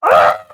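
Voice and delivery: loud exasperated scream; frustrated scream. Real, not acted. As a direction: let out a scream that collapses into laughter. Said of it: "I'm losing control again".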